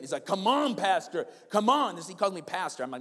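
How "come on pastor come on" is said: louder, higher urging; mock coaching cheer. Lewis urging the tired pastor on, coaching shout. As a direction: push him like a running coach. Running partner urging him on.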